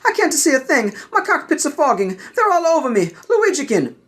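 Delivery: high, alarmed pilot voice; panicked Jedi pilot. Acted. Jedi pilot calls his apprentice for help, high and alarmed. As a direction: call for help, cockpit full of smoke.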